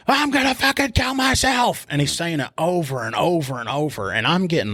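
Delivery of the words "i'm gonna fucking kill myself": much higher, louder mock scream; imitated screaming. Suspect screaming threats from the back seat, mock shriek. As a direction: wail it like a man losing it in a cop car. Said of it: "screaming and everything".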